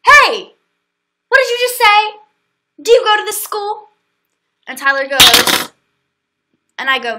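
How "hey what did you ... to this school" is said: loud, high scolding shout; angry mom yell. Retold or imitated. Soccer mom yelling at Tyler, angry high shout. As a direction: bark at the kid like an outraged parent.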